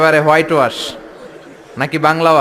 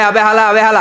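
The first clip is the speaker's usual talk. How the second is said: put-on Old Dhaka slang; 6 semitones higher, 7 dB louder than usual. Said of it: "খালি কয়".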